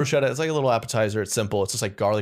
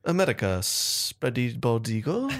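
slow, halting fake French accent; put-on French accent, drawn out; mock French accent; 3 semitones higher, much slower than usual. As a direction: stretch it out in comic French. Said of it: "I just like doing that accent".

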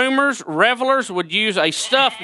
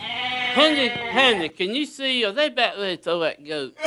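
acted husband; 3 semitones higher, 5 dB quieter than usual. Plays dad filming goat throw.

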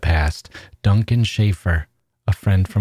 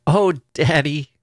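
cracking with laughter; 6 semitones higher than usual. A narrator's reading. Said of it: "Her voice cracked with laughter".

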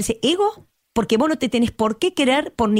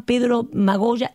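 ordinary delivery

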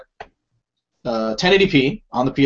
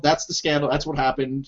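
ordinary talk

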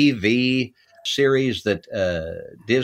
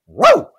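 dog bark; 10 dB louder than usual. Shown as a demonstration. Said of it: "They asked me to audition my bark".

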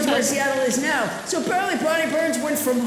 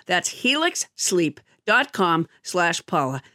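ad read; 8 semitones lower, slower than usual. Acted. Sponsor ad read.

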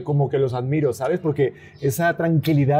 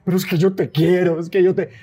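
higher, passionate protest of love; raised, impassioned voice; 5 semitones higher, 3 dB louder than usual. Shown as a demonstration. Husband showing how he gets passionate with his wife, raised voice. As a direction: plead your love with a raised voice. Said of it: "empiezo a alzar la voz".

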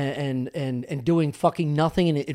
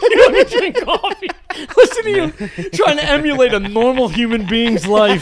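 teasing through laughter; laughing mockery; 8 semitones higher, 6 dB louder than usual. Real, not acted. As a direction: laugh at him while you tease. Mocking friend while laughing.

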